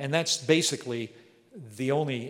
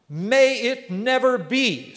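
much higher and louder, slow and forceful; emphatic denial; 10 semitones higher, 10 dB louder, slower than usual. Shown as a demonstration. Paul rejecting that faithlessness nullifies God, loud slow emphatic cry. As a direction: declare it like an outraged refusal. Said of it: "as strong a negative as Paul can possibly say".

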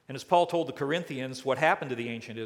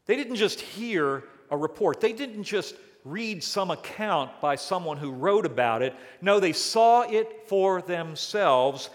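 raised, higher, building to "saw"; rising preaching; 5 semitones higher, 5 dB louder than usual. Real, not acted. Preacher pressing the point to the congregation, loud and high. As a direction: preach it out with force.

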